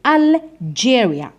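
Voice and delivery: الجيريا said slowly with a clear English "j"; pronunciation demo. Shown as a demonstration. Teacher shows how this letter is pronounced, slow pronunciation demo. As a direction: stretch الجيريا so the "j" stands out. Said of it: "ننطقوها".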